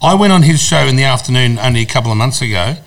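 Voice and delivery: usual speaking voice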